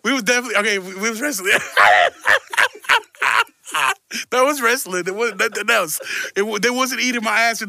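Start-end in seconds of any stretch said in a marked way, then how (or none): 1.6-3.4 loud staccato cackle; real belly laugh. Real, not acted. Host laughing at his own wrestling defence, loud cackle. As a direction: break into loud, pulsing laughter.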